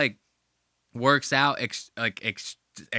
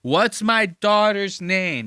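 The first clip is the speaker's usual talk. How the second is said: slow, high, loud mimicry; mocking echo; 7 semitones higher, 6 dB louder, much slower than usual. Host parrots the podcaster's question, mocking.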